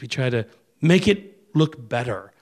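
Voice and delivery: usual speaking voice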